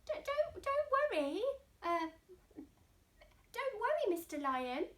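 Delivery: high stammering squeak; peeping mouse. Acted. Mouse peeping reassurance to the lion, high. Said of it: "Mouse peeped".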